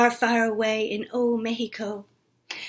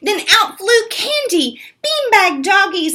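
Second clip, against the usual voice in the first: ordinary delivery, nothing marked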